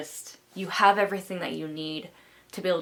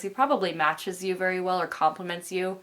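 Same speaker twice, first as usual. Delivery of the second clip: usual speaking voice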